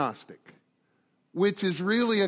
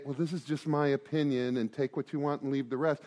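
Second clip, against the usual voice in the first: mock softening; 5 semitones lower, 6 dB quieter than usual. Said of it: "I'm not gonna soften it and say".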